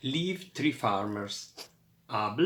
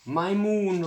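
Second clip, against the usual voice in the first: high, louder storybook roar; 7 semitones higher, 6 dB louder than usual. A narrator's reading. Ziki answering Nigel, mock roar. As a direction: bellow it back at Nigel. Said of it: "roared Ziki".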